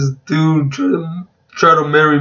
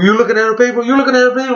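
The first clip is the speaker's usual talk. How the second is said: much higher, rising mock accusation; accusing teacher; 8 semitones higher, much faster than usual. Teacher catching a student cheating on a test, accusing. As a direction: imitate a suspicious teacher, pitched up high.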